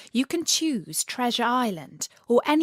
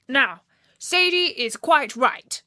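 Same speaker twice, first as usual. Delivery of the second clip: lightly voiced frog prince, louder, slow; 5 semitones higher, 5 dB louder than usual. Frog Bertie lightly voiced calming the meeting. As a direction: soothe the swan first. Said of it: "he said".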